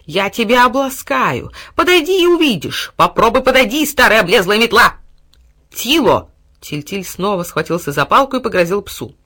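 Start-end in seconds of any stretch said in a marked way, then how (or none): 0.0-5.0 high, loud snarling threats; threatening dog. A narrator's reading. Dog threatening the ivy. 5.7-6.3 sharp call. A narrator's reading. Boy threatens with stick.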